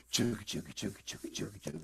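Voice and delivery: low, very soft whispered chugging; choo-choo train. Acted. Coaxing kitty to take medicine, whispered train chugging. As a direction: puff out a steady train rhythm under the breath. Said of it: "here comes a choo-choo train".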